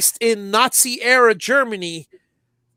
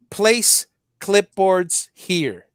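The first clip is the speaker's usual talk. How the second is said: read out slowly; much slower than usual. Retold or imitated. Reading a sign slowly.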